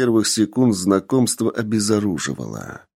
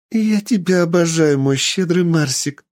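high, delighted squeal; squealing with joy; 8 semitones higher than usual. Acted. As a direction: squeal it with glee. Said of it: "завизжала от радости Ева".

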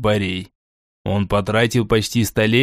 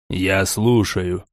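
low, slow commanding voice; stern low lord; 6 semitones lower, slower than usual. Acted. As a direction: drop low and take your time. Borey answers his fey.

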